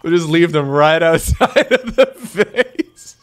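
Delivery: slow words broken by laughter; laughing slowly. Real, not acted. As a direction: laugh through the line. Laughing at the plan.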